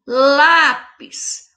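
"Lápis" with a long, firm "Lá"; strong first syllable. Shown as a demonstration. Teacher showing pupils the strong syllable, "Lápis" drawn out on "Lá". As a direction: stretch and stress the opening of "Lápis". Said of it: "Qual que foi a sílaba forte".

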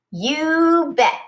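Lucy answers her father, excited. A narrator's reading. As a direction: chirp it out keenly. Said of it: "she says excitedly".

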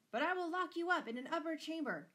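high, loud counter-threat; angry bellow. A narrator's reading. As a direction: top her with a shouted threat. Father's threats escalate in quarrel.

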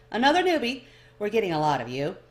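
a hint of a cheery dwarf hailing a newcomer; lightly voiced jovial dwarf; amicable dwarf. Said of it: "His voice is amicable".

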